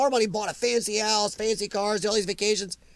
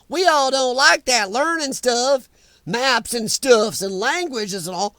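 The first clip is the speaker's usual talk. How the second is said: mock Southern accent; 2 semitones higher, 4 dB louder, slower than usual. Mocking CNN's jab at Trump voters in a slow Southern accent. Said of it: "Giving all Trump voters a Southern accent".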